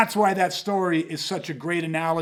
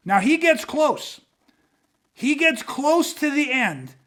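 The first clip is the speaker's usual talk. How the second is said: slow, higher-pitched storytelling; suspenseful slow-down; 7 semitones higher, slower than usual. Real, not acted. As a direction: draw it out to build suspense. Climax of the pool story.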